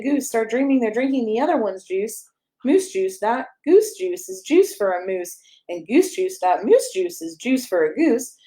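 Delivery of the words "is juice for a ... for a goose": plain reading voice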